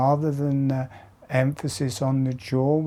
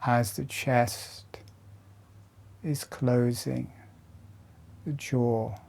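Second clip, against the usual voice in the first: guided meditation; 4 dB quieter than usual. Acted. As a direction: lead them gently through each breath. Guides slow jaw breathing exercise.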